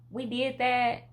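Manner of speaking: loud, high proud cheer; triumphant. Real, not acted. To her viewers, celebrating being cancer-free, a triumphant cheer. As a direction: proclaim the victory. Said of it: "celebrating beating cancer".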